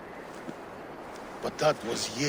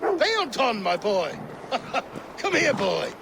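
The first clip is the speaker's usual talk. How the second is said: joyful calling; 10 semitones higher than usual. Acted. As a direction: call the dog over, delighted and laughing. Joyfully calling his dog.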